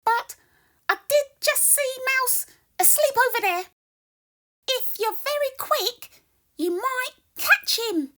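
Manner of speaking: high, loud squeaky mouse voice. Acted. Mouse continues.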